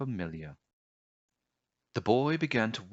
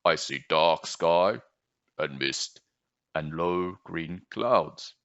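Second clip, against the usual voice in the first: low frog croak; 3 semitones lower, slower than usual. Acted. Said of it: "asked the frog".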